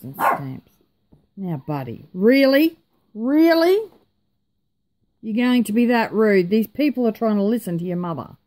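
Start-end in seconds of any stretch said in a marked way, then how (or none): 2.1-7.1 high, loud indignant really; incredulous scolding. Real, not acted. Mother to rude son, indignant disbelief. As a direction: pull him up on his rudeness.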